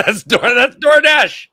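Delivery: loud high slow shout. Real, not acted. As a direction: shout the punchline. Shouted repeat of joke.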